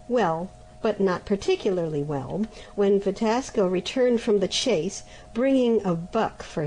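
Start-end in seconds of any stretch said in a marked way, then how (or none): none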